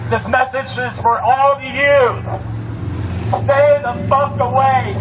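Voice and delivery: pissed off, high and pushing hard; fired-up angry rally speech. Real, not acted. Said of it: "Yes, I'm fucking pissed".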